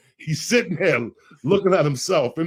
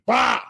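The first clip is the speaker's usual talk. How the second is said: firecracker bang; 9 semitones higher, 5 dB louder than usual. Retold or imitated. Imitating a firecracker going off.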